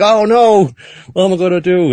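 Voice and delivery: high, loud mock panic; freaking out. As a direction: wail it in cartoonish alarm. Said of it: "Joseph's freaking out".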